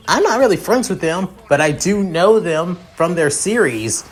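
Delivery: excited fan. Acted. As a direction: rattle off who they are with a touch of fan excitement. Fan character gushes on meeting heroes.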